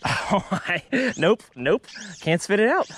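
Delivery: ordinary delivery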